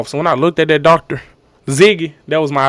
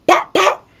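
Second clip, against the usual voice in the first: two high, short imitated pops; popping noise; 13 semitones higher than usual.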